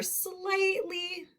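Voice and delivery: slightly drawn out slowly on a high pitch; stretched-out word. Shown as a demonstration. Teacher uses the word in a sentence, slightly stretched high. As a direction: hold slightly long and high, sound by sound.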